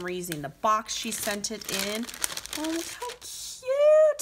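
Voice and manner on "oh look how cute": very high, slow, drawn-out coo; cute squeal. Real, not acted. Vlogger gushing over a gift in the box, high slow coo. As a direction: melt over something adorable.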